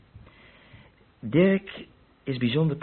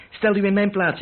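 higher, louder plea; raised plea; 8 semitones higher, 5 dB louder than usual. Acted. Suspect pleads with the inspector, louder and higher. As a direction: push back, begging to be understood.

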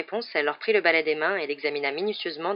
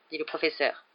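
plain reading voice

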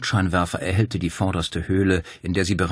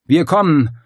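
loud, much higher call; shouted reply; 9 semitones higher, 9 dB louder than usual. Acted. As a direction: call out to the men. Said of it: "rief er".

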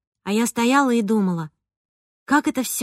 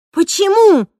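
offended; 9 semitones higher, 9 dB louder, slower than usual. A narrator's reading. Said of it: "оскорбилась я".

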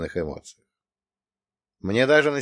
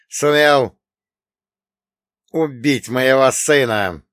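медленно, потом громко и зло; цедит, потом срывается; со злостью; 7 dB louder, slower than usual. Acted. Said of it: "Наконец выдохнул он". (с нарастающей злобой)

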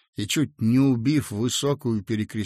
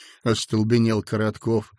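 ordinary delivery

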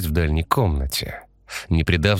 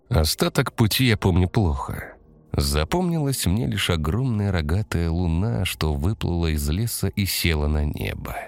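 usual speaking voice

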